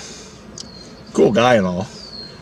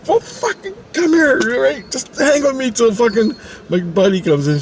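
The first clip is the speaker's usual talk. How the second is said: high, urgent begging; retold frantic coaxing; panicked pleading; 12 semitones higher than usual. Begging Dawn to hang on until buddy comes, panicked pleading.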